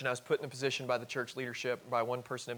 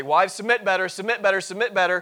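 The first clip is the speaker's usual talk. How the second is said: loud, high, insistent repeated demand; mock drill-like badgering, high and loud; 6 semitones higher, 12 dB louder than usual. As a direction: hammer out a nagging order, pitched up. Mimics demanding preaching at wives.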